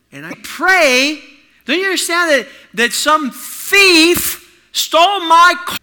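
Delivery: loud, high, slow indignant shout; furious outburst. Retold or imitated. Teen yelling at Dad after his car was stolen. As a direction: hurl it out in disbelief.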